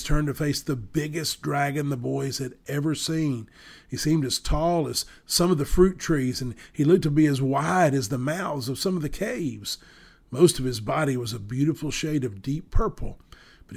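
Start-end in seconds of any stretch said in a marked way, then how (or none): none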